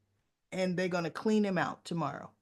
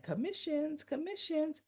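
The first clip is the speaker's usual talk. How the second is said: high, softer singsong to herself; imitated gleeful inner thought; 9 semitones higher, 5 dB quieter than usual. Agent privately thinking of her earnings, mock gleeful singsong. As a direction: chant it high like a secret little thought.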